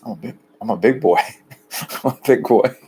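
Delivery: mock childish boast; teasing himself as a big boy, childlike and playful. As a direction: say it like a proud little kid. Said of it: "self-play".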